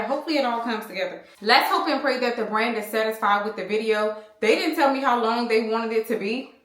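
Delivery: usual speaking voice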